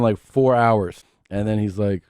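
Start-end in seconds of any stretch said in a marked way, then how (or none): 0.0-1.0 drawn out. Retold or imitated. Dad retells reply to son.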